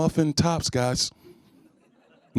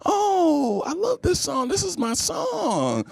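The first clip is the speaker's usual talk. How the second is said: put-on high gushing girly voice, much higher; carefree single woman; 10 semitones higher, much slower than usual. Impression of a single woman clubbing, high gushing voice. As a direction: gush over your song. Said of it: "this is my impression of single women".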